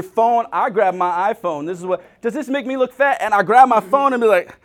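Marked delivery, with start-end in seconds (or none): 2.2-3.2 higher, lightly voiced wife; a light take on the wife's question; mock wife asking. Husband voicing his wife asking if she looks fat.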